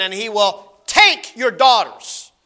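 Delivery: "take" drawn out and weighted; stress on take. Shown as a demonstration. Preacher reading Samuel's warning, slow stress on "take". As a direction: slowly lean on "take". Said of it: "I'm gonna emphasize a word".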